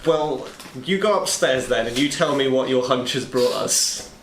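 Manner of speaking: ordinary delivery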